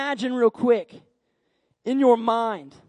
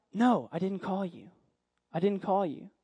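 lower, softer, lightly acted mentor; weary pastor; 4 semitones lower, 7 dB quieter than usual. Pastor answers boy's third visit, weary. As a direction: deny it quietly and patiently.

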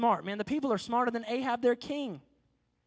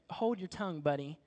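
low warning; 6 semitones lower, 7 dB quieter than usual. Retold or imitated. Elijah silencing the king.

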